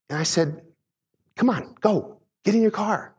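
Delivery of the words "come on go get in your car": high, mock shooing urging; shooing away. Dad shooing a stranger toward his car, high and urging. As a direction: imitate hurrying someone off. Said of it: "I, I shushed him".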